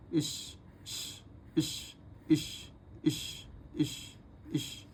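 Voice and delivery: breathy hissing इश, very slow; whispered sh sound. Shown as a demonstration. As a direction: let the इश hiss out with no voice. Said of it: "इसको पढ़ते हैं हम इश".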